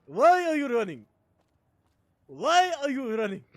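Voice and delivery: put-on shouted high meme quote in a Nigerian accent; Nigerian accent impression. Streamer repeating a meme, shouted Nigerian accent. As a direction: yell it in a mock Nigerian accent. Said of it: "He sounds Nigerian. With that accent".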